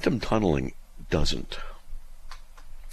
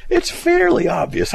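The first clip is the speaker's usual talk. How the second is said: much higher and louder sarcastic cry; mock outrage; 8 dB louder than usual. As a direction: throw the phrase back, incredulous. Mocking the letter writer's phrase.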